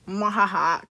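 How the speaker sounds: low evil villain laugh; mustache twirling villain. Pretend. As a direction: cackle like a cartoon villain. Gel kills sperm, evil laugh.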